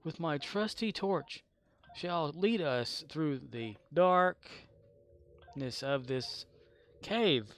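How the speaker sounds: slow, drawn-out mock-epic proclamation; grand speech with long pauses; mock heroic. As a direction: stretch it out like a hammy adventurer leading the party. Plays heroic torch bearer.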